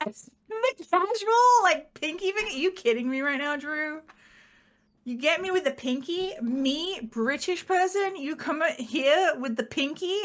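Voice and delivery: mock outrage. Mock outrage at Drew's pinky.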